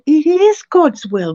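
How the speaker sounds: "It is God's will" slow, high and forceful; emphatic insistence. Retold or imitated. Insisting to doubtful praying believers, emphatic.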